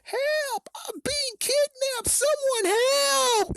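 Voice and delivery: shrill mock victim shouting for help; fake cry for help. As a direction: overplay a doll pretending he's being kidnapped. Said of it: "Slappy decided to play the victim".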